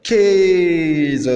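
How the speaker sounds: "Käse" with a long é vowel; demonstrated pronunciation. Shown as a demonstration. Teacher giving the A umlaut example, demonstrated pronunciation. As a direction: stretch the first vowel of "Käse" as é. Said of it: "c'est le E".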